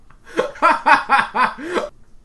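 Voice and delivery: helpless burst of loud, high laughter; high laughing fit. Real, not acted. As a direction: crack up at the absurd line. Laughing at the child's line.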